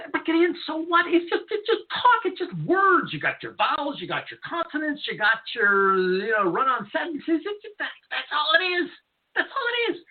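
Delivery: high-pitched, exasperated rant. Real, not acted. Host insists to critics it's just talk, exasperated. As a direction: dismiss it all in rising disbelief.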